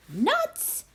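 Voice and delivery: high squeal of delight; monkey squealing at the word nuts; excited cry. A narrator's reading. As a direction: shriek it up high. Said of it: "cried the monkey".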